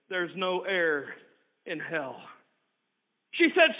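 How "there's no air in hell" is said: low, soft, slow final verdict; lightly voiced grim keeper, dropping low; cold keeper. Keeper of hell answering the sister, low and soft.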